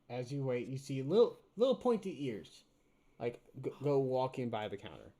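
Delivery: usual speaking voice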